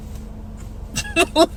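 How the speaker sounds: mock stifled giggle, whispered; imitated breathy laugh. Imitating a passerby laughing as she runs off, stifled giggle. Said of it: "starts laughing".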